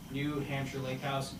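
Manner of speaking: ordinary talk, nothing marked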